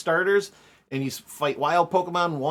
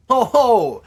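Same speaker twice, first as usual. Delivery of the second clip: loud booming ho-ho laugh; hearty ho-ho; 8 semitones higher, 11 dB louder than usual. Pretend. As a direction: belt out a jolly ho-ho. Playful mock laugh at game.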